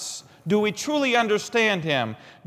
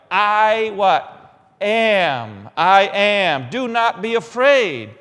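call-and-response preaching; 5 dB louder, much slower than usual. Acted.

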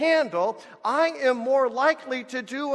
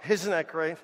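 ordinary delivery

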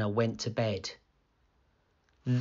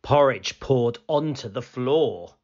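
ordinary delivery, nothing marked

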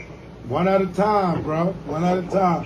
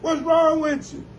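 higher, hammed-up disbelief; mock outrage; 6 semitones higher than usual. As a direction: play it up as if you're shocked. Mock outrage at shooting talk.

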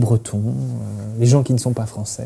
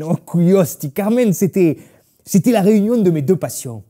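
excited; 5 semitones higher, 8 dB louder than usual. Acted. Grandfather recalls his passion.

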